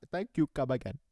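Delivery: lightly imitated Indian accent; mock Indian accent. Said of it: "goes right back to Indian".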